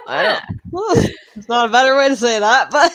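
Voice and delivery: fast, loud, laughing through the words; laughing while talking. Real, not acted. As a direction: giggle out an awkward remark. Embarrassed laughing about fertility line.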